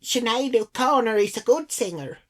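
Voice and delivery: badly faked Irish woman's voice; bad Irish accent. Irish lady standing up for [name], bad Irish accent. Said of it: "so [name]'s like".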